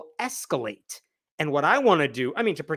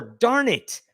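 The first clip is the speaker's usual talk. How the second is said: mock frustrated curse; 6 semitones higher than usual. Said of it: "you don't use that tone".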